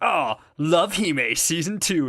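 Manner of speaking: hammed-up delighted answer; acted realization. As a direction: stretch it out like a big discovery.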